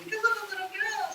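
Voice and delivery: put-on high, hushed child voice; coy fake child answer; very high, soft little girl voice. Spirit posing as child.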